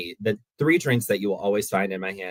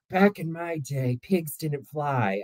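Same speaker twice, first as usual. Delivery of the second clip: put-on slow, higher old-person grumble; old-timer; 3 semitones higher, 3 dB quieter, much slower than usual. Imagining being old at Disney, slow old-timer voice. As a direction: play a dotty old relative, dragging the words. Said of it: "that's the person I wanna be when I get older".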